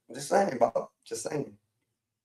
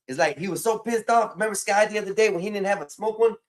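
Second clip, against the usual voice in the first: normal talking voice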